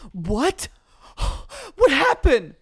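high, loud distraught gasping mom; imitating his mom's shocked gasp and outcry. Mom hears a celebrity died, distraught gasp. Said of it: "my mom was distraught".

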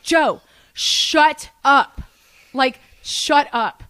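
slow, exasperated yell, easing on the repeat; acted-out scream. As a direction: stretch each word, firmly silencing a friend. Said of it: "sorry if I just screamed".

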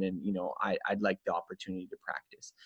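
drops to an unvoiced whisper; meek, barely-there voice asking for a chance; timid whispered plea; hushed whisper. Shy learner answering a dismissive waiter.

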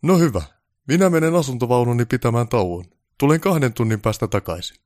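loud, high-pitched announcement; imperious sign-off to the crew; pompous director, dismissive. Acted. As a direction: declare your break like a star director. Voices Baltasar leaving for his break.

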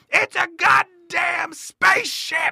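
imitating the magician's triumphant bellow; booming showman shout. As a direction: belt it like a magician revealing the big trick. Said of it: "And he's like".

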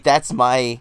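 ordinary talk

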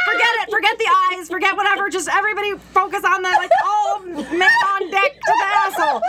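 high, loud mock command shout; commanding muscles. Her muscles rallied like a ship's crew, loud orders. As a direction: bark orders like a captain to the crew. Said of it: "every muscle was like".

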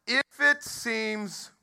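slow, high, stretched reading of "If it seems"; "If it seems" dragged slowly for emphasis; drawn-out reading. Shown as a demonstration. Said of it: "Now watch this".

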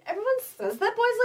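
ordinary talk, nothing marked

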